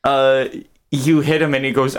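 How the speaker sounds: ordinary talk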